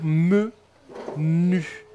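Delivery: "me", pause, "nu", both drawn out; syllable by syllable. Shown as a demonstration. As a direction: sound out "me" and "nu" separately. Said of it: "On reprend".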